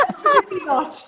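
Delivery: high laughing refusal. Real, not acted. Laughing refusal to let him go.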